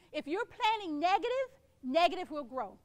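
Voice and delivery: usual speaking voice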